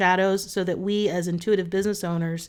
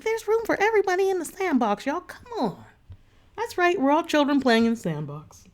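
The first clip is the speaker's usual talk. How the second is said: playful high voice; 6 semitones higher than usual. Retold or imitated. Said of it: "I'm like".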